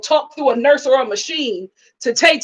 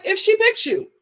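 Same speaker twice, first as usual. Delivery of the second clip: high, pointed mock reproof; blunt mom correction; 9 semitones higher than usual. Mom correcting her lovestruck son, pointedly. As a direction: put him straight in a raised pitch. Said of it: "she was talking real".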